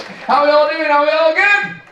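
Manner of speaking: high, loud hype questions; loud, high emcee call to the crowd; crowd warm-up. Acted. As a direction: pump up the room. Host warming up the audience.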